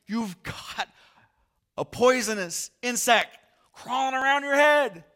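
very high, loud warning yell; alarmed shout. Retold or imitated. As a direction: scream the warning. Warning about poisonous insect.

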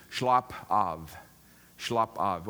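"slapp av" said the Norwegian way, as shlahp ahv; "slapp av" with a Norwegian sh at the start and an open ah; Norwegian word. Shown as a demonstration. Pastor teaching the congregation the Norwegian "slapp av". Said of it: "the word they use is".

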